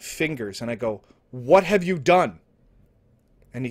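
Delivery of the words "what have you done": loud, raised-pitch acted outcry; alarmed demand. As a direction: demand it loudly, acting aghast at a friend. Confronts Frank as craft appears.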